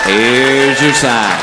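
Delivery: very slow, low, dragged catchphrase; deadpan punchline. Acted. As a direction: stretch each word to milk the laugh. Catchphrase punchline after a joke.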